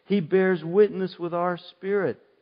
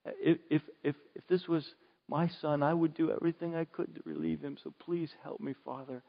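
hushed, fading, intimate prayer; soft prayer; 7 dB quieter than usual. Shown as a demonstration. Preacher showing how he prays, hushed and fading. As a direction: pray softly to a father. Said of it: "I say".

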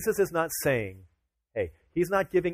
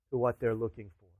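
ordinary delivery

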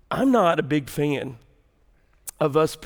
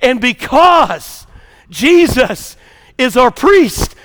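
loud, emphatic pulpit preaching; full-voiced sermon delivery; 13 semitones higher, 15 dB louder than usual. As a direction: drive the point home to the congregation. Preaching climax.

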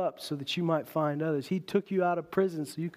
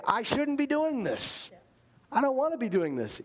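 anguished protest; 9 semitones higher than usual. Acted. A believer's conscience objecting to sin, high-pitched protest. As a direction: cry it out as a convicted conscience.

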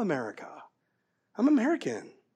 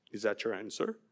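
very low, hushed questioning; mock-solemn quiet query; serious examiner; 10 semitones lower, 14 dB quieter than usual. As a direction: play the grave official, barely raising the voice. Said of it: "so serious".

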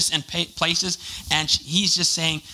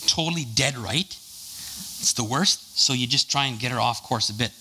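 usual speaking voice

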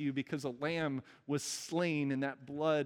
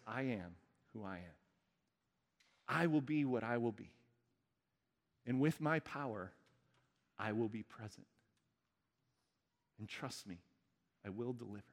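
lower, much softer steady promise; God speaking; 5 semitones lower, 5 dB quieter than usual. Retold or imitated. God telling his people he will deliver, softly. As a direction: speak God's words calmly and low. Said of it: "God says".

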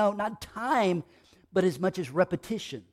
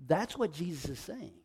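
usual speaking voice

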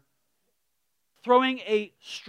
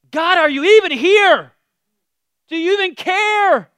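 loud, much higher cry to God; desperate Job; 9 semitones higher, 12 dB louder than usual. Retold or imitated. Job crying out to God for help. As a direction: plead like an abandoned man. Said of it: "Job kinda goes".

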